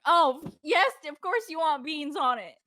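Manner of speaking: high, loud mock agreement; sarcastic. Server reacting to customer wanting beans too, sarcastic. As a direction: agree with exaggerated exasperation, near laughter.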